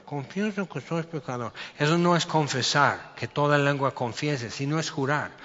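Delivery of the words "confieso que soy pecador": softer, rote repeat of "confieso que soy pecador"; mock a hollow, murmured confession; mumbled, hollow sinner's prayer; hushed mock prayer. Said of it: "la oración del pecador".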